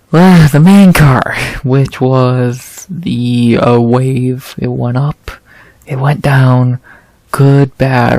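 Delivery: ordinary talk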